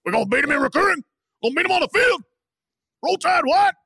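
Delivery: hammed-up locker room rant; mock coach rant. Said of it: "He's sending it to all the guys".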